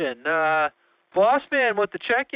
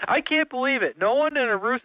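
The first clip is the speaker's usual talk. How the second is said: ordinary talk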